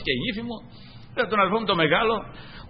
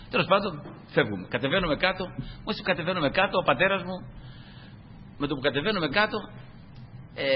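normal talking voice

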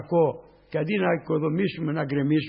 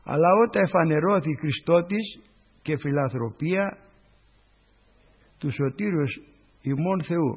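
solemn scripture reading; 2 semitones lower, slower than usual. Acted. Reads scripture verse slowly.

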